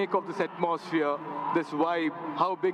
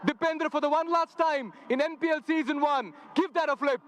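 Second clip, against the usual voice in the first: big stadium MC hype; rally the crowd like an MC; announcer; 7 semitones higher than usual. Real, not acted. Hyping crowd at the toss.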